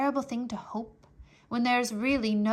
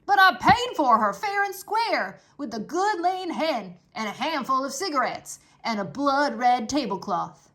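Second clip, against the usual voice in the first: protesting Uncle; 3 semitones higher, 8 dB louder than usual. Acted. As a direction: plead his case, affronted.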